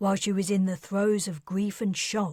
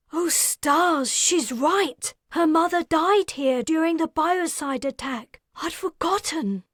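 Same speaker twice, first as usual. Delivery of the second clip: high, loud, stunned voice; shocked realization; 9 semitones higher, 5 dB louder than usual. Acted. Wilder gasping as she realizes the truth, high and loud. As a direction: realize it with a gasp. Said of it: "Wilder gasped".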